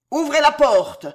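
high, very loud command; booming, urgent shout; thundering. A narrator's reading. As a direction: thunder the order at full voice. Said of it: "tonna Montmorency".

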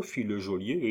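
usual speaking voice

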